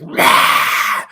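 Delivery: hammed-up screech of fright; over-the-top frightened shriek, played for laughs; mock horror-movie scream. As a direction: imitate a hysterical old-movie scream. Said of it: "every time she sees something, she's like".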